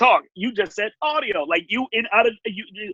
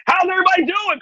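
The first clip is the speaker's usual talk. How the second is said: much higher and a bit louder, big energetic call on everybody; hyped crowd greeting; 13 semitones higher, 3 dB louder than usual. Shown as a demonstration. Host demonstrating the Nickelodeon crowd trick, pumped-up call. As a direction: throw it out to the whole room with full showman energy. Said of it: "No, no, this is old Nickelodeon trick".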